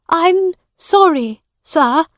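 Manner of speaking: high, loud apology; begging. Acted. Woman to the judge, begging. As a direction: plead with the judge. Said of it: "begged the woman".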